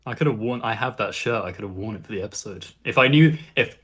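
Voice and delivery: ordinary delivery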